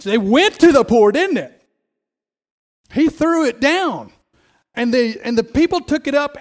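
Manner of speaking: loud, high push on they went to the poor; fiery preacher driving the point home; emphatic preaching. Real, not acted.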